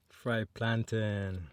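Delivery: normal talking voice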